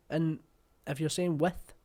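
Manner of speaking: ordinary delivery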